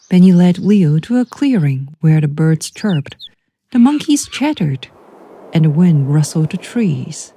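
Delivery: plain reading voice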